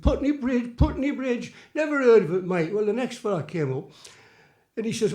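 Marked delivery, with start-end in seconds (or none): 0.0-2.7 high-pitched stranger puzzling over the name; baffled passer-by. Acted. Next man stopped for directions, baffled and dismissive. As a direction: repeat it in bafflement, then dismiss it. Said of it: "He said".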